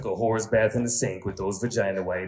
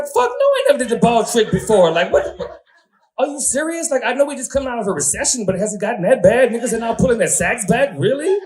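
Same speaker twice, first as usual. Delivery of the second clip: loud, high disbelieving yell; loud, high, outraged disbelief; outraged shout; 13 semitones higher, 8 dB louder than usual. Retold or imitated. Outraged reaction to ball trick.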